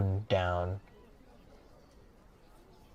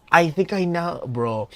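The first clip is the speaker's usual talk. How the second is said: ordinary talk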